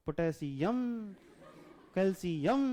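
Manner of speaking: Potassium and calcium with "यम" stretched and rising; reading for small children. Shown as a demonstration. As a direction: show how to teach a child, syllable by syllable, lingering on "यम". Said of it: "छोटे बच्चों को बेटा ऐसे ही पढ़ो". (बच्चों को पढ़ाने जैसे "यम" खींचकर)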